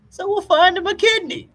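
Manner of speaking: breezy cartoon squeak; Lewis Lewis. Telling the kidney scene, high mock voice. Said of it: "He's like".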